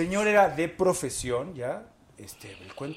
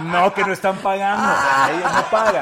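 louder, laughing through the words; 5 semitones higher, 13 dB louder than usual. Real, not acted. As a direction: laugh it out while telling it. Laughing about unpaid modelling job.

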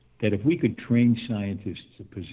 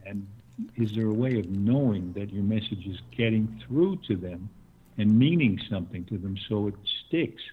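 normal talking voice

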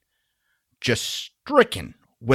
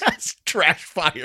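laughing; 5 semitones higher, slower than usual. Real, not acted. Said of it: "Pause for effect".

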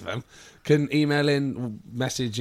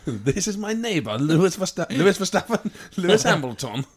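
mock Kazakh-style comic accent, raised and loud; Borat; 4 semitones higher, 3 dB louder than usual. Joking that the driver is Borat's cousin, comic foreign accent. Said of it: "Borat's cousin".

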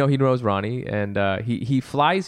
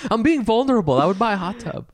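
mock earnest; 9 semitones higher than usual. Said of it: "You shoulda done it that way".

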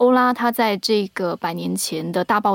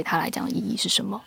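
soft whisper; 8 dB quieter, faster than usual. Real, not acted. Story summary trails to hush.

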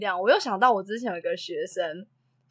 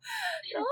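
a short high-pitched laugh; 10 dB quieter than usual. Real, not acted. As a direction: laugh high and quick at the mystery. Laughing at the mystery. (短促的高声笑)

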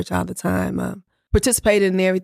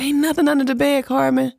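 dismissive brush-off; 7 semitones higher than usual. Retold or imitated. Sleepy husband dismissing wife.

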